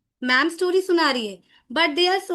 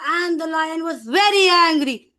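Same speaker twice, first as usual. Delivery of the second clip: storytelling that jumps loud on VERY ANGRY; loud demonstrated anger; raised voice; 5 dB louder than usual. Shown as a demonstration. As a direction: raise the voice suddenly to startle the class. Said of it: "the moment you raise your voice".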